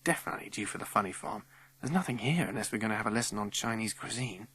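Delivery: quick, hushed aside; whispering. A narrator's reading. Child whispering to a friend in class, quick hushed aside. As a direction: murmur it softly and fast. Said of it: "I whispered to Strawberry".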